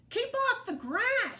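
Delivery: very high, loud grumpy shout. A narrator's reading. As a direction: bark the order at the cowboy. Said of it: "shouted Hank".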